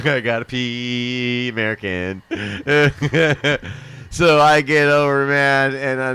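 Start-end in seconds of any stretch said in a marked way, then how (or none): 0.1-2.2 a desperate, slowed plea to be let through. Retold or imitated. At the border gate, begging the guard to let him pass, slow and strained. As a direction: draw it out slowly, pleading to cross. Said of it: "the guy's all".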